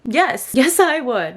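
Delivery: high, eager quoted acceptance; excitedly acting out saying yes; eager retold yes. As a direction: play up the eager agreement.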